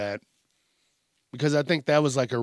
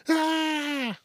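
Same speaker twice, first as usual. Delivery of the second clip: high, short mock shriek; mock scream; 14 semitones higher than usual. Host mimicking people who scream at fights, mock shriek. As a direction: imitate a bystander's high scream.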